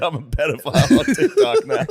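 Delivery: high, loud, laughing mock quote; laughing requote. Teasing requote amid laughter.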